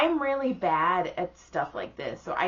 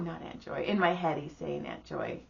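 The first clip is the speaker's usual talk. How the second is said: ordinary talk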